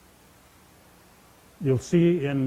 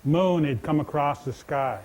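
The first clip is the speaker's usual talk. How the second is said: ordinary delivery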